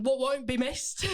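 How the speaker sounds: lightly done Mrs Lovett's cut-off London speech; Lovett Cockney accent. Shown as a demonstration. Actress demos Mrs Lovett's accent for the hosts, clipped Cockney. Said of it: "she's got a very cut off accent".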